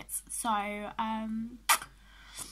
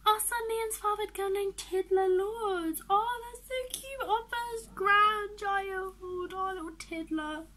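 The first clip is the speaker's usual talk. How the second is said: high-pitched doting coo; high, delighted fussing; gushing; 6 semitones higher, 4 dB louder than usual. Real, not acted. Gushing over first grandchild.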